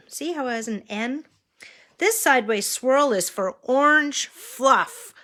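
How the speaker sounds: sing-song picture-book voice; reading to a toddler. Acted. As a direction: point out each picture to a small child. Pointing at pictures for child.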